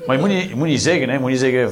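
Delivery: usual speaking voice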